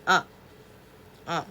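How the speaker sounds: 아 with the mouth wide open; open ah demo. Shown as a demonstration. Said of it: "이렇게 표현하는 거야". (입을 크게 벌린 아)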